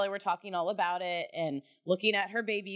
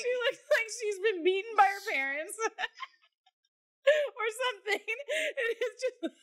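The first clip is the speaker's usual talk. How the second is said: laughing; 13 semitones higher than usual. Real, not acted. Laughing at daughter's awful photo.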